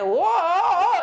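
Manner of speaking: a sudden high, loud laughing "whoa"; startled whoa. Retold or imitated. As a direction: throw out a mock-startled gasp of a word. Grandma caught by gremlin, startled.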